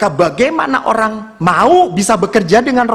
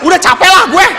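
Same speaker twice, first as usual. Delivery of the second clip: high, loud comic complaint; mock exasperation; 10 semitones higher, 5 dB louder than usual. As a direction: give up praying in a raised whine. Retelling own exasperated giving up. (keluhan jengkel yang dilebih-lebihkan)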